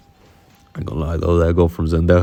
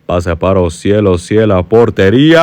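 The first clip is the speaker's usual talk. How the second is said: play-by-play commentary; 2 semitones higher, 7 dB louder than usual. Acted.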